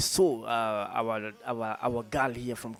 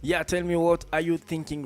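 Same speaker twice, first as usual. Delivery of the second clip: calling out; 5 semitones higher, 6 dB louder than usual. Real, not acted. DJ calls out to listeners.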